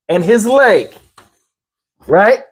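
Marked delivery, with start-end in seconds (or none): none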